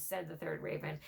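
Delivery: ordinary delivery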